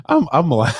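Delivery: breaking into real laughter mid-word; cracking up so hard the word breaks off; laughing uncontrollably. Real, not acted. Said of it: "I'm laughing uncontrollably here".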